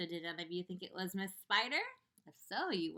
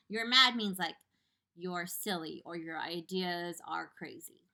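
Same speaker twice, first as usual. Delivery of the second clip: ordinary delivery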